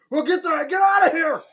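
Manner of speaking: very loud, high retold scream; full-volume alarmed shout; panicked yell. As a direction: shriek at a friend to flee.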